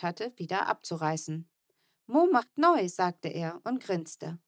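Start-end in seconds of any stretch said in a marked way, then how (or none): 2.1-2.9 put-on high, louder little-kid voice; toddler voice. A narrator's reading. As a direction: play the little boy proudly announcing. Kleiner Bruder Mo spricht.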